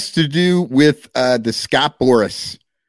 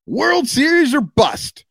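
mock gung-ho fan; 5 semitones higher than usual. Reading a fan's bold tweet.

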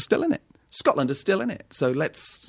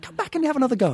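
high imitation of director; 14 semitones higher, faster than usual. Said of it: "He was like".

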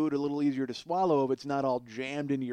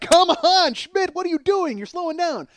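high, loud shouted jeer; crowd heckling; 11 dB louder than usual. Retold or imitated. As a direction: yell at him to hurry. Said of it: "everybody's like".

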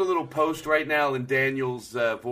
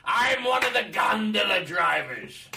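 high, slow shouted voice; high shouting gondola driver; 8 semitones higher, slower than usual. Pretend. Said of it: "I could have easily done this".

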